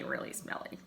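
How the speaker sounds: a whispered punchline. Acted. Teasing the children about being smelly, in a whisper. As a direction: drop to a whisper on the joke.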